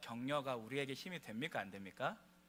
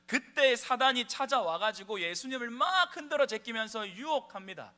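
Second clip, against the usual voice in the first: higher, louder impassioned sermon; fervent preaching; 8 semitones higher, 8 dB louder than usual. Acted. Pastor urges the congregation about Jesus, high and loud. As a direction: drive the message home with rising fervor.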